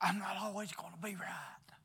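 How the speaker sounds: sudden whisper; hushed humble admission; whispered confession. Real, not acted. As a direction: drop to a whisper.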